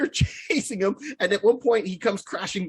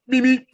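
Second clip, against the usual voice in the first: high, loud beep-beep; Roadrunner impression; 5 semitones higher, 6 dB louder than usual. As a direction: chirp it like the cartoon Roadrunner. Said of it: "took off like the Roadrunner".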